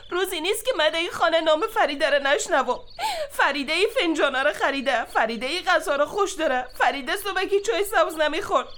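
ordinary talk

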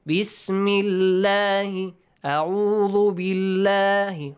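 Bismillahi, a'udhu billahi chanted slowly with light L; light, flat lam. Shown as a demonstration. Teacher repeating the kasra examples, billahi with light L, drawn out. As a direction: stretch Bismillahi and billahi, each L thin and flat. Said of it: "a flat sound".

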